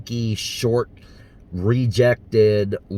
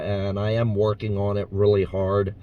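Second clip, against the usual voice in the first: normal talking voice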